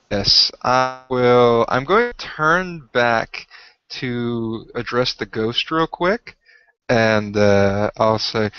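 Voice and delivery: ordinary delivery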